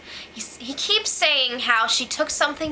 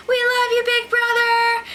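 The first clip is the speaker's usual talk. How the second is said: mock fan yell; 10 semitones higher, 3 dB louder than usual. Imitating fans outside the wall, high mock yell. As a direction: call out over the wall like a fan. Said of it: "fans yelling outside over the wall".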